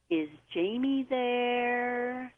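slow, soft caller stretching out there with a French lilt; imitating a faraway man's voice, slow and stretched; drawn-out ghostly voice. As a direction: draw every word out like a faint, distant caller. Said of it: "the voice extended the syllable there like he had an accent".

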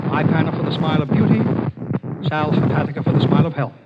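brisk, fast sponsor plug; ad read. Acted. Host reads the sponsor plug, radio ad voice. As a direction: sell both products briskly.

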